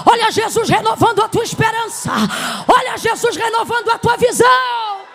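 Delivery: loud, fervent pulpit preaching; preaching at full force. Acted. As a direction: preach it hard to the congregation.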